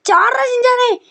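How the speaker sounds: very high frantic yell; jump shout. Acted. Telling Shinchan to jump out of the auto, frantic yell. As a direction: scream at him to jump.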